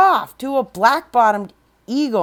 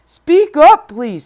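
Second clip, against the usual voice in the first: much higher, louder call; calling to be heard; 6 semitones higher, 10 dB louder, slower than usual. Acted. Horton asking the faint speck voice to speak up, calling. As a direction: lift the voice so a tiny voice can answer.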